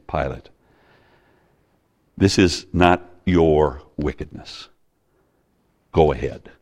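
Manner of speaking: hushed, lightly voiced Jesus; gentle, compassionate. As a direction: quietly grant him pardon. Jesus gently releasing Pilate.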